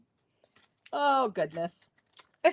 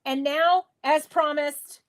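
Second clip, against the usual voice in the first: ordinary talk, nothing marked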